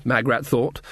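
plain reading voice

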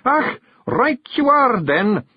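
high Scots brogue, drawn out; bearded mystery man. A narrator's reading. Bearded stranger accepting the ride, high Scots. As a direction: agree in a high, slow Scottish voice.

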